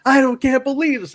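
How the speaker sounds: high-pitched outraged protest; mock pissed-off disbelief. Bravo fuming before being yanked back, high mock indignation. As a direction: imitate an indignant betrayed man. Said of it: "He was all pissed off".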